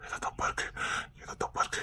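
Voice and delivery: tense, barely audible whisper. Acted. Husband warning wife something is wrong, whispered. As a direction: breathe it out in alarm. Senses danger, hides it.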